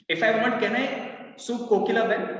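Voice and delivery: ordinary talk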